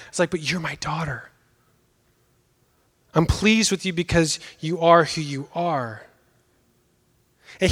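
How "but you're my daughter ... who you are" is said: tender reassurance. Retold or imitated. Father reassuring daughter softly.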